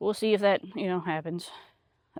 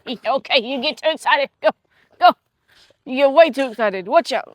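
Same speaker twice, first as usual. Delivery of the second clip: louder, higher telling-off; real dog scolding; 5 semitones higher, 8 dB louder than usual. Real, not acted. Owner to her excited dog, loud playful scolding. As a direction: rein in an over-excited dog, raised voice.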